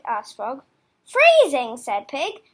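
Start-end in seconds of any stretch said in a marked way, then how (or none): 1.1-1.8 incredulous, louder; amazed at the question; surprised. Acted.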